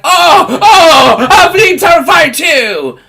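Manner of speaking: high, loud, laughing mock excitement; hammed-up smug fan bragging. Vlogger mocking fans who skipped the first film, laughing shout. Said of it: "because you're like".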